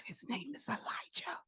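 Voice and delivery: breath-soft, stunned reveal; awed whisper. Retold or imitated. Foster mother retelling the moment she heard his name, awed whisper. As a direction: whisper it as if overcome.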